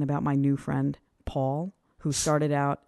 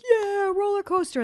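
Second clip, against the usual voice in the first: excited; 13 semitones higher than usual. Retold or imitated. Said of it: "been like".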